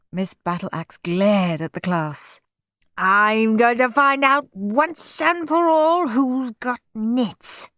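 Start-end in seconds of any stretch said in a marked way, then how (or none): 3.0-7.7 a hint of a stern slow growl; growling teacher. A narrator's reading. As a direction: threaten the class. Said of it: "she growled".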